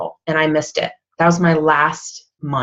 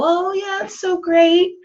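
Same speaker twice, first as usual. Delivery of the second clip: much higher sarcastic gush; mock gushing; 12 semitones higher than usual. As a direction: fake cheerful chirp. Mocking own old Facebook post.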